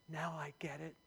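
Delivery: very soft, musing to oneself; hushed realization. Acted. Peter scratching his head as he understands, hushed. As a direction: let it dawn quietly.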